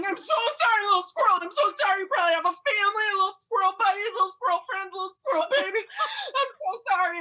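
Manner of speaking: high, loud, hammed-up tearful wail; wailing apology. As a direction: sob out apologies at the top of your voice. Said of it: "I'm, like, wailing".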